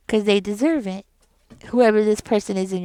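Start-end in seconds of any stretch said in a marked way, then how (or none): none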